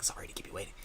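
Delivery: low raspy whispered Snake. Acted. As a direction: breathe it low and soft like Snake. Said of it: "and then Snake going, it's like".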